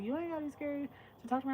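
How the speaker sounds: imitating coworker. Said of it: "he said something like".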